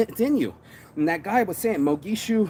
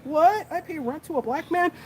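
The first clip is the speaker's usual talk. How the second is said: high shrill-free mock shock, much higher; 12 semitones higher than usual. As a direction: play the outraged landlady's disbelief, way up high. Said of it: "She was like".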